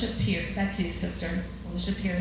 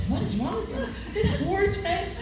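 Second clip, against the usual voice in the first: much higher mock disbelief from a friend; exasperated friend; 13 semitones higher than usual. Friend Alicia at the lunch table, high mock disbelief. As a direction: squeak it out like she can't believe you. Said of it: "she's like".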